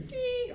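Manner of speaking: high beep sound; a thin imitated tone; imitating the beep of the hearing test. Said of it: "Then you hear".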